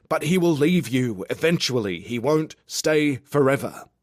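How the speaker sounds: loud, high nagging yell; yelling inner voice. A narrator's reading. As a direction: yell it like a voice through a megaphone. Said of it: "megaphone and started yelling".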